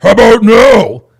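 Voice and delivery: loud flat dismissal; loud retort. Real, not acted. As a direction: shut the question down loudly.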